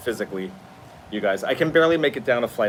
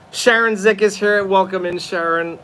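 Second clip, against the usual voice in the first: usual speaking voice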